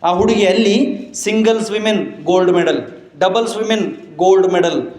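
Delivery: rousing sermon climax; triumphant preaching. Acted. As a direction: announce the victory to the crowd.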